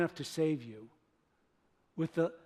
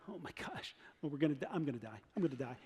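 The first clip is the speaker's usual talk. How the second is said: whispered, lower panic; terrified whisper; 5 semitones lower, 11 dB quieter, faster than usual. Retold or imitated. Frightened Israelite stepping through the sea, whispered terror. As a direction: breathe it out in fear. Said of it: "they start going".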